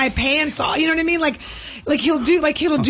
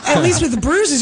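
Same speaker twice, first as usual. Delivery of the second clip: ordinary talk, nothing marked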